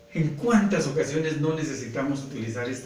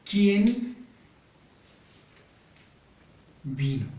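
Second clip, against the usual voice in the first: slow, split into separate words, ¿Quién higher and louder than vino; dictating while writing; 5 semitones higher, 4 dB louder, much slower than usual. Shown as a demonstration. Teacher writing the example question for pupils, slow and broken up. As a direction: say "¿Quién" and "vino?" apart, as if writing each on the board. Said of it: "aquí vamos".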